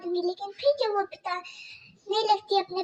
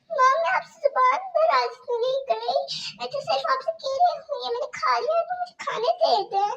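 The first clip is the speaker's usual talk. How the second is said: put-on much higher, small pleading girl voice; pleading young girl; 6 semitones higher, faster than usual. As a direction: Kajal begging her stepmother about stomach pain.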